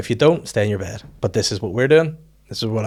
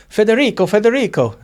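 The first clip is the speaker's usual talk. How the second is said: high, raised call of a name; calling out for son; 10 semitones higher, 5 dB louder than usual. Acted. Coffee man calls his burned son in, calling out. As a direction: shout for your little boy.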